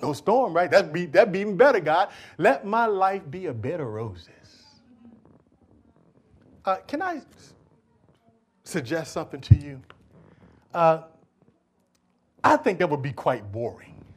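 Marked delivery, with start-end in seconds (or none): none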